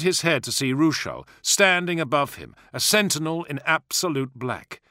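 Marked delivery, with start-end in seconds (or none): none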